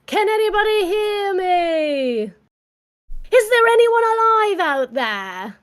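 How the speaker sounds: put-on high, slow British-accented calling into the night; Titanic survivor accent. Host redoes the Titanic line, high, slow, British-accented. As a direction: hold each word like a lifeboat searcher. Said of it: "let me do it again".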